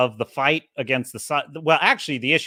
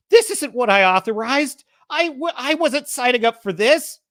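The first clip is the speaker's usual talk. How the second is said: higher, strained, horrified protest; 9 semitones higher, 3 dB louder than usual. Retold or imitated. Gaeta protesting to Zarek over the bodies, horrified and pitched up. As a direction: recoil from what you authorized. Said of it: "He's horrified".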